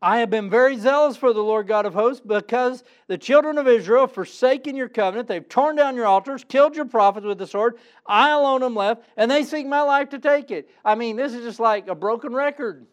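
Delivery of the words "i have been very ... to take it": higher, louder insistent complaint; loud, pitched-up protest; complaining Elijah. Acted. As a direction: repeat the grievance like a broken record. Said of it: "I'm gonna give you the same answer".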